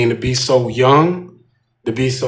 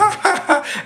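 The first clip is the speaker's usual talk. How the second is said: mock laugh.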